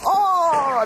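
ordinary talk, nothing marked

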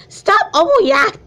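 wrong character voice. Acted. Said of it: "I got the wrong voice".

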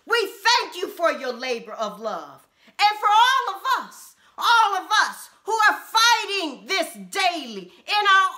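ordinary delivery

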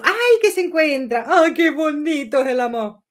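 a dreamy, lifted ay, qué bonito; gushing. Real, not acted. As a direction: gush over the lovebirds. Gushing over the couple.

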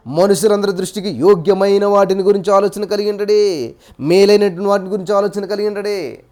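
loud, emphatic scripture quote; preaching verse. Acted. Preacher proclaiming scripture to his listeners, loud and emphatic. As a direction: drive the verse home to the congregation.

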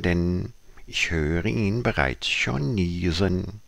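declaimed verse with swooping, lifted pitch; poem recitation. Acted. Tiger reciting a poem about a beetle with a cold, theatrical declamation. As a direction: recite it theatrically, stretching the key words.

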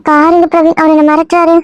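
a lightly put-on girl's voice, hurt and coy; woman's voice. Disguised man plays the girl wooed by the husband. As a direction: play the wronged young woman, only lightly feminine. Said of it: "இன்னும் பொம்பளை குரல்ல தான் பேசுற".

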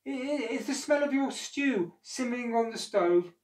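high, stammering excuse; enormous lady lying to the giant; nervous excuse. Acted. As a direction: stammer a quick cover story. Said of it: "said the enormous lady".

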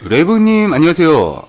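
high, loud happy welcome; excited greeting. Real, not acted. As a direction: greet them with delight. Streamer greeting a viewer.

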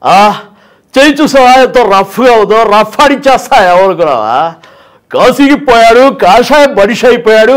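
loud, forceful mass-hero punch dialogue; rough Chiranjeevi dialogue. Retold or imitated. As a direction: thunder it like a film hero's warning. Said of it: "Chiranjeevi గారు కూడా సినిమాల్లో చాలా రఫ్గా".

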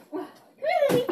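high sing-song call to the dog; high pet voice. Real, not acted. Owner coos her dog's name high.

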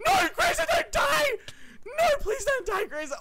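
very high squealing panic; frantic pleading. Real, not acted. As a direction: beg your buddy to stay alive, voice shooting up. Pleading as teammate fights in game.

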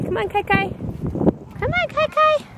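high sing-song calling. Real, not acted. Mom calling her toddler son at the park.

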